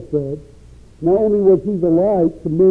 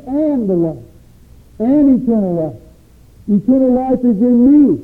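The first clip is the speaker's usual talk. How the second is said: full-force preaching; 3 semitones higher than usual. Acted. Preacher declaring Jesus's words to the congregation, full force. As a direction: preach it, building the claim up.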